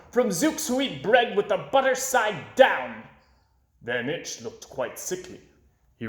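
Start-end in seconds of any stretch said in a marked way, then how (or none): 0.1-3.0 higher, firm and stern; a boy scowling, laying down the law; stern frowning boy. A narrator's reading. Boy at the wall warns Van Itch, sternly. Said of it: "I said with a frown".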